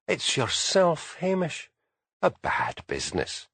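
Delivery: lightly voiced grizzled old Highland butler; a slow, hint of an old servant's lilt; lightly voiced old Highland manservant, slow. A narrator's reading. Tom Kelly, old Highland butler.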